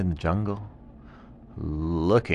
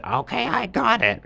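high, chirpy monkey voice; monkey ready to help; pleased monkey; 3 dB louder than usual. Acted. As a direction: announce it brightly high. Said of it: "the monkey came out and said".

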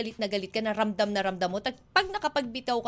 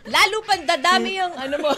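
high-pitched hex with a laugh in it; playful witchy threat; mock witch curse; 7 semitones higher, 3 dB louder than usual. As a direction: cast a teasing spell, half laughing.

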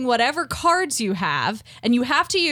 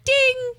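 ding sound; 14 semitones higher than usual. Shown as a demonstration. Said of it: "we can be like".

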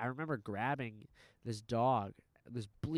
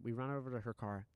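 normal talking voice

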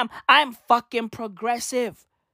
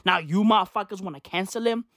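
ordinary talk

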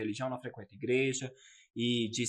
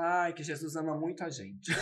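laughing; 2 semitones higher, 6 dB louder, slower than usual. Retold or imitated. Citando a própria resposta fingida.

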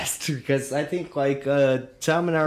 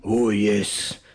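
usual speaking voice

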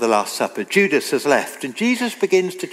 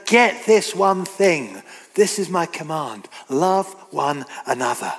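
slow, deliberate weight on "get this one thing"; solemn emphasis. Retold or imitated. Preacher giving Jesus' final command, slow and emphatic. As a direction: lay each word down slowly and firmly. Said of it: "He says it three times with special emphasis each time".

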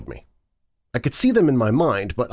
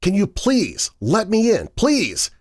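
pleading; 12 semitones higher, slower than usual. A narrator's reading. Begging at her locked door.

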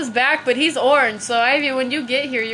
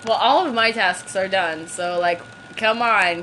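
ordinary delivery

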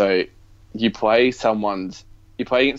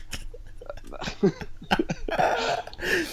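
long breathy laugh; 7 dB quieter than usual. Real, not acted.

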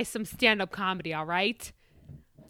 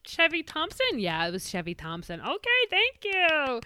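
announcer; 9 semitones higher than usual. Acted. Host closes the show.

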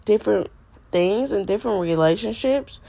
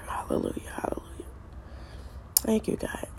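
whispered praise; 12 dB quieter, faster than usual. Real, not acted. Quiet praise under breath.

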